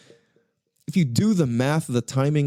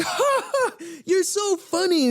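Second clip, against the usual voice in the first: much higher, giggly fake-laughing voice; mock fake laugh; slower than usual. People laughing off Jarrell's insult, high fake laughter. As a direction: squeal it out with a forced laugh. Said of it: "people will be like".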